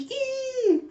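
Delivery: very high, held fangirl squeal; delighted squeal. Acted. Swooning women answering Jaeha's flirting, high squeal.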